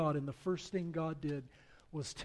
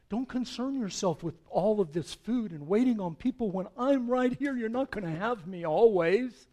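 climbing higher and louder with each plea; urgent; 5 semitones higher, 6 dB louder than usual. Acted. God urgently pleading with his people, rising to a loud call. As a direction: press harder with every command until it rings out. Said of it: "Every one of these times there is urgency".

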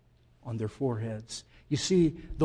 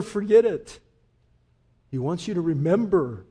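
loud, high impassioned preaching; full-force preaching; 4 semitones higher, 10 dB louder than usual. Acted. Pastor preaching comfort to grieving listeners, loud and high.

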